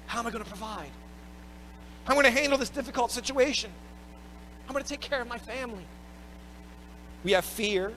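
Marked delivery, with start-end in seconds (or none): 0.1-5.9 worried voice. Retold or imitated. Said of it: "We worry".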